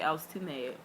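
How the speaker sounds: usual speaking voice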